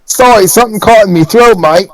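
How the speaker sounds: a hint of cockney goblin; goblin excuse. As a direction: cover the slip as Dubs. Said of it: "It's Dubs".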